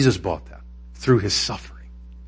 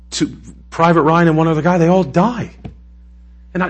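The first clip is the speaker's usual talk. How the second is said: usual speaking voice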